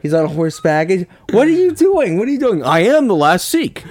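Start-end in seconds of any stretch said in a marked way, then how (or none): 1.3-2.6 high, loud alarmed cries; hammed-up frantic movie extra; frantic shout. Mock movie scene, frantic extras. 2.6-3.8 hammed-up epic hero. Mock epic hero on horseback.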